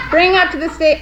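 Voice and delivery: muffled through a hot mask; inside a rubber mask. Real, not acted. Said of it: "I'm hot in this mask".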